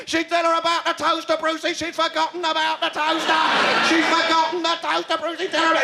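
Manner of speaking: high, urgent cries at the telly; frantic, high-pitched fan urging the host on; excited game-show fan. Acted. Young addict urging Brucey from the sofa, excited.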